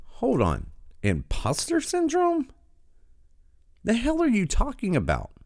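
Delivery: scoffing at the ad's imposter syndrome line; incredulous. Real, not acted. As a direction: stretch it out in sheer disbelief.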